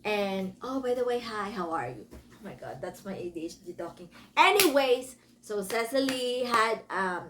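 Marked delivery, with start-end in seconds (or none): none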